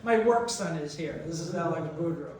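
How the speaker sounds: normal talking voice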